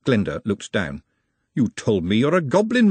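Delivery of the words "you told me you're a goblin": higher-pitched, hurt accusation; first, more contained reproach; reproachful. Acted. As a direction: confront him, holding some force back. Glenda confronts Nut over his lie.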